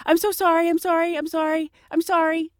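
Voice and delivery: pleading to dodge a ticket; mock nervous apology. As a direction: play the scared driver to the cop. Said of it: "I act so scared and nervous and fumbling".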